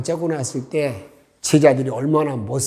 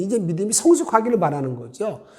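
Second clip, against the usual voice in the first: ordinary delivery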